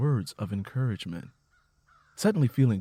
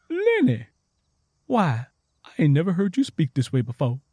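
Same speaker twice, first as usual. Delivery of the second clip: surprised; 5 semitones higher, 5 dB louder than usual. Acted. Tangy surprised.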